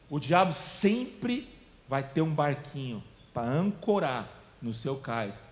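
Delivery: ordinary talk, nothing marked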